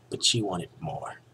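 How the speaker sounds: ordinary delivery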